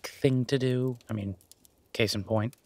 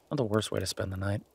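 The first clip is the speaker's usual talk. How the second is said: ordinary talk, nothing marked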